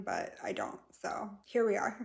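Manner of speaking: whispered aside. Real, not acted. Aside to viewers while the husky settles, whispered. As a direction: drop to a whisper so as not to disturb.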